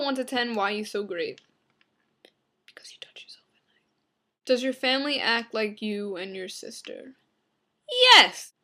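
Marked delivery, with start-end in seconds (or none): 2.7-4.5 very soft, voiceless whisper; creepy whisper. Pretend. Vlogger answering why they're so great, whispering. 8.1-8.5 short, very loud burst; yelled yes. Pretend. Vlogger answering about the family, shouting.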